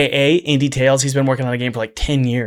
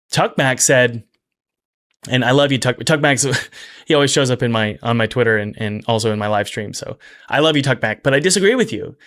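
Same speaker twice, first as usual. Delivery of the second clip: usual speaking voice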